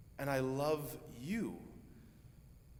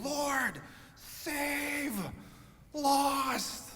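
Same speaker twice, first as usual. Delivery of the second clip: desperate high shout; frantic scream; 11 semitones higher, much slower than usual. Retold or imitated. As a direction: scream to be heard over the storm. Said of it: "So John's shaking the Lord".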